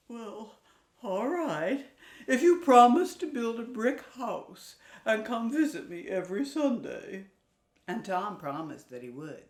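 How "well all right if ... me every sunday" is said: reluctant mama pig. Acted.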